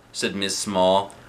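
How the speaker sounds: ordinary delivery, nothing marked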